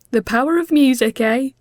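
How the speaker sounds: playful teasing line; cheery quip. Acted. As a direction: toss it off with a grin. Mel jokes after shared laughter.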